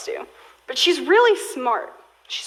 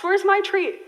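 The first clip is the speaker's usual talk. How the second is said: higher, whiny little ask; pleading dog voice; 6 semitones higher than usual. Retold or imitated. Hagar as a dog asking for her treat, mild whine. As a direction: mimic a pet begging. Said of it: "She sits here and asks".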